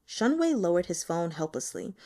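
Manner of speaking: ordinary delivery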